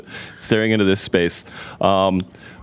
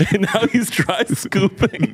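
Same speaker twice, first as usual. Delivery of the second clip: laughing while quoting; 7 semitones higher than usual. Retold or imitated. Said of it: "And I'm like".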